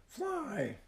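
put-on very high, squeaky baby bird; little bird voice. A narrator's reading. Blaze the firehawk says her first word, tiny high squeak. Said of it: "Blaze said again".

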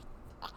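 sharp intake of breath; real astonished gasp; surprised gasp. Real, not acted. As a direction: gasp at sudden news. Reacting to news of home test.

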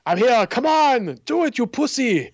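high, loud taunting Terminator; taunting shout. Retold or imitated. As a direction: egg them on, daring them. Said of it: "he was just like".

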